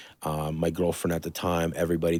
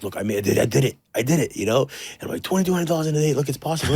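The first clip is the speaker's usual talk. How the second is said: excited; 7 semitones higher, faster than usual. Retold or imitated. Calling his dad after a big sales day, thrilled and high. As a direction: shout the good news like a thrilled kid.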